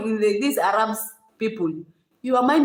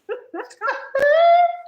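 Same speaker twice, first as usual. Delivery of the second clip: high-pitched imitated sob, louder at the end; mock crying; 5 dB louder than usual. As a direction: break into a hammed-up wail. Acting out her crying reply.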